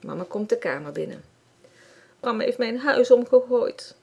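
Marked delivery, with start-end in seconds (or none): 2.2-4.0 high, loud crying complaint; little sister crying to mum about her house. Acted. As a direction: wail it to mum, a hurt little girl. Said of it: "huilt Marloes".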